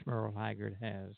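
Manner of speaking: ordinary talk, nothing marked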